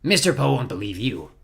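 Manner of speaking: ordinary delivery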